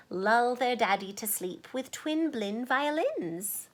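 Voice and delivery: usual speaking voice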